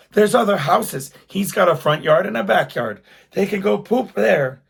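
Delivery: hammed-up indignant wife insisting; exasperated wife. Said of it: "she's just mad".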